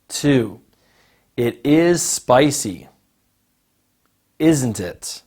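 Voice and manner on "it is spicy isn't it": slow, isn't it falling; falling tag. Shown as a demonstration. Teacher models a falling question tag for the listening task. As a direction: let isn't it drop.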